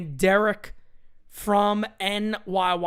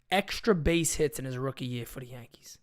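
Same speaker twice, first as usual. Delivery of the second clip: dramatic whisper; 8 semitones lower, 8 dB quieter than usual. Real, not acted. Streamer to his viewers, stat-hyping whisper. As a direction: drop to a hushed whisper to land the stat. Stressing rookie stats to viewers.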